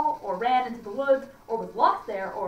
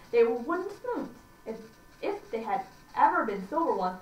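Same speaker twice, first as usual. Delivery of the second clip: usual speaking voice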